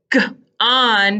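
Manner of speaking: G alone, a pause, then ON; teacher sounding out g...on; isolated G. Shown as a demonstration. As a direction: split gone into g- and on. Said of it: "sounds with the g".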